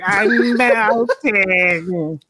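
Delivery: very slow, drawn-out witch wail; melting witch impression. Likening a film character to the Wizard of Oz witch, melting witch impression. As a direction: stretch it out like the dying witch. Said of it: "He sounds like the witch".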